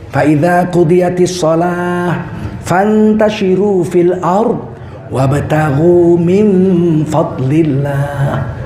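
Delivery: slow, chanted Arabic recitation; slow Quranic chanting. Acted. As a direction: recite the verse with reverence.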